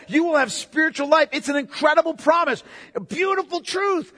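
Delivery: high, driving sermon crescendo; impassioned preaching. Acted. Jesus promising eternal life to believers, impassioned preaching.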